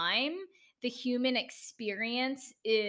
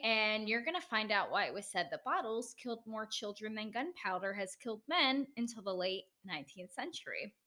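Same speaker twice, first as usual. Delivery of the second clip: ordinary talk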